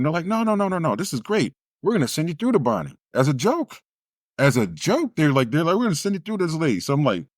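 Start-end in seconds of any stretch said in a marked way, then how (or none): 0.3-2.9 mock receptionist. Mocking receptionists voiced. 5.8-6.8 mock receptionist. Mocking receptionists voiced.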